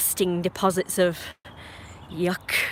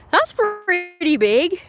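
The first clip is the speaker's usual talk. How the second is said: mock surprise; 10 semitones higher, 5 dB louder, much slower than usual. Mock gasp at own weight.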